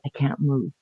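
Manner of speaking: very soft retold reply; hushed, imitating a paralysed boyfriend; frozen helplessness. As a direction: barely get the words out.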